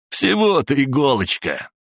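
high-pitched belittling jeer; amused mockery. A narrator's reading. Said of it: "засмеялся Сандер".

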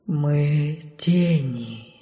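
very slow, low and soft; drawn-out ghostly answer; slow shadow voice. A narrator's reading. (тягучий голос тени)

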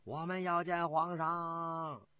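long drawn-out, sustained call; crowd shouting. Acted. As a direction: cry out slowly as a mob of soldiers. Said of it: "喊叫着". (拖长声喊叫)

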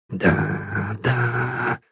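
very soft, muttered to himself; muttering under breath. A narrator's reading. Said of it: "пробормотал он себе под нос".

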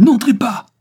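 high, loud yelled command; screamed protest. A narrator's reading. Sonia trying to stop the police, screaming. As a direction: yell to stop them going in. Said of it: "hurla-t-elle".

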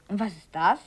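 ordinary delivery

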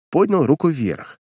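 usual speaking voice

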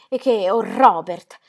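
più forte e più lenta, accorata; esclamazione. Acted. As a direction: esplode rivolgendosi al marito. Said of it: "esclamò allargando le braccia". (la moglie esclama forte e lenta, oh Robert)

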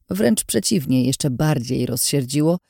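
ordinary delivery

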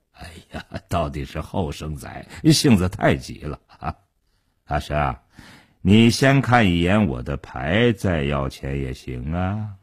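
put-on languid older man, deep-pitched; unhurried. A narrator's reading. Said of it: "傻彪边说边不慌不忙地".

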